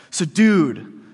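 laid-back "So dude" with a falling "dude"; casual dude voice. Shown as a demonstration. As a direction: say it like a buddy calling out. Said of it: "that's kinda how it would sound".

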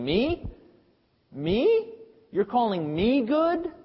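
high, rising disbelief at being called good; incredulous Jesus. Retold or imitated. Jesus questioning the kneeling man, incredulous. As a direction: echo the word back, stunned. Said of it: "Jesus is in effect saying".

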